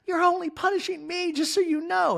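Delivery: a hurt, high-pitched complaint; hammed-up Kyle impression; mock Kyle. Voicing Kyle.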